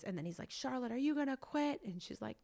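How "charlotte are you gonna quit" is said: high, pressing coach's challenge; imitating tough coach. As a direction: call the kid out like a coach. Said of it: "kind of berating her".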